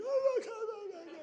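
high-pitched mock wail, fading away; imitated overcome leaders. Leaders answering "What do you think?", high mock wailing. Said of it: "They're like".

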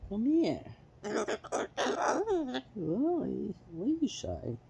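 high sing-song call; calling the pet. Real, not acted. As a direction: coax him over with a lifted call.

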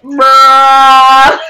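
one word held out loudly, level pitch; long loud bruh. Retold or imitated. Kid replying to the pizza guy, long loud bruh. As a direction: drag it out as a loud mock comeback. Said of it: "then I'm like".

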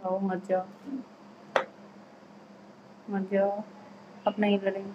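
very soft, low, subdued begging; coaxing a partner to stay, barely raising the voice; quiet pleading. Real, not acted. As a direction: plead in a small hushed voice, with long silences. Wife begs husband not to leave.

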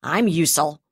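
loud and hard; harsh, hard-edged. A narrator's reading. Brigadier answers the commodore harshly. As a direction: spit your own name out. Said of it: "in a harsh, hard-edged voice".

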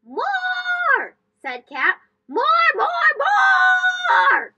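very high, loud cartoon shout; greedy shout. Kat demanding more treats.

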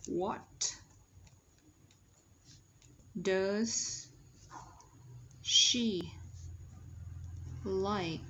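one word at a time, long pauses; dictation pace. Shown as a demonstration. Teacher dictating for students to write, slow separated words. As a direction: dictate "What does she like?" word by word.

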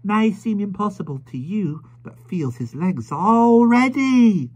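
high, slow and loud, word by word; a proud young mother shouting into an old man's ear; high, slow, proud mother's shriek; shrieking to a deaf man. A narrator's reading. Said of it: "shrieking these short sentences into the old man's ear".

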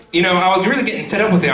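ordinary delivery

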